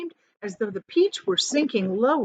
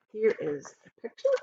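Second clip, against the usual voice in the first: usual speaking voice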